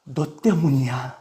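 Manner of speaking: low, slow, soft accusation; subdued mock accusation; acted blaming. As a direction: lay the blame quietly and heavily. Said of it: "그리고 또 말합니다".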